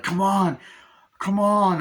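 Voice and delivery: high, loud beckoning call; acted getaway shout; calling out urgently. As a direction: holler for them to catch up. Calling others onto the train.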